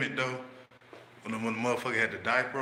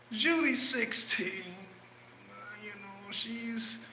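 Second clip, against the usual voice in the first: higher, very slow and soft; whiny put-on voice; 10 semitones higher, 5 dB quieter, much slower than usual. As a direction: drag the words out meekly. Friend answers in put-on voice.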